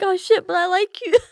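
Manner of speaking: high, whimpering protest; mock whine. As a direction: whine it with pretend heartbreak. Acting out reluctance to fight idol.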